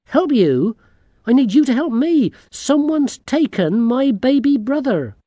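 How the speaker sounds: high, loud, urgent demand; exasperated girl. A narrator's reading. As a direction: throw it back at them indignantly. Girl turns the plea back.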